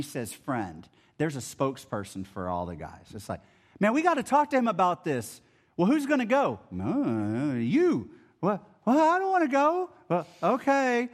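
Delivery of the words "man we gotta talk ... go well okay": quick, higher, comic back-and-forth voices; bickering workers. Retold or imitated. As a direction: play the nervous workers passing the buck. Workers choosing a spokesperson.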